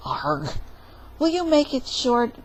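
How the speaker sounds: normal talking voice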